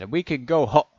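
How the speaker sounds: shouted appeal, interrupted. A narrator's reading.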